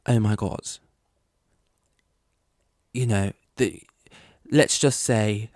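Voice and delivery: slow, drawn-out pundit voice, pausing for drama; Will Buxton impression. Vlogger mocking Will Buxton's dramatic F1 soundbites, slow mock pundit voice. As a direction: mimic a TV pundit building up to a soundbite. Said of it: "Will Buxton dropping his sound bites".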